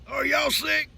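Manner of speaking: high, pitiful voice; imitating Doc. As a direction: ask it like the suspicious sick friend. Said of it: "And he says".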